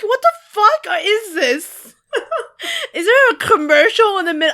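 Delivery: high, loud disbelief breaking into laughter; high, laughing outrage at a commercial; incredulous. Retold or imitated. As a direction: react like it can't be real. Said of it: "I'm like".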